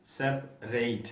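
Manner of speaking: सेपरेट slow, ending "-rayt"; verb form. Shown as a demonstration. Teacher to students, slowly modelling the verb form. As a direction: stretch the final "reit" in सेपरेट. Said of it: "पढ़ा जाता है, सेपरेट".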